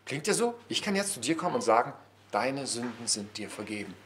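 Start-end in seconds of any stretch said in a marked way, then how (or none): 2.3-3.9 low, hushed pronouncement of forgiveness; acted absolution. Preacher telling a listener their sins are forgiven, low and hushed. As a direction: say it low and softly, as if absolving someone.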